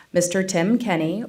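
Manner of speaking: ordinary talk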